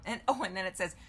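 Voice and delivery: usual speaking voice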